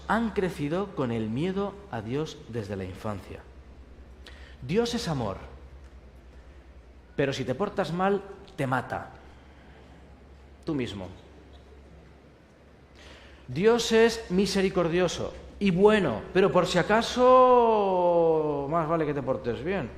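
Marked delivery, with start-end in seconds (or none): 4.6-11.1 soft, ominous, sarcastic; deadpan the veiled threat; mock threat. Parodying fear-based preaching, quietly sinister. 13.5-19.9 slow, sly, menacing aside; mock warning. Parodying a God to be feared, sly warning.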